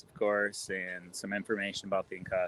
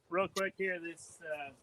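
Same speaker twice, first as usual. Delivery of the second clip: far from the mic; 8 semitones higher, 8 dB quieter than usual. Real, not acted. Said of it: "We can't hear you".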